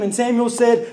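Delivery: ordinary delivery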